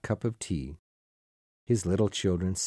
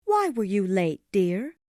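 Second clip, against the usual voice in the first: high-pitched wife questioning her husband; high, gentle-questioning character voice; concerned wife; 13 semitones higher, 4 dB louder than usual. A narrator's reading. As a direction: ask as a wife, in a much higher voice. Narrator voices Mrs Cratchit asking husband.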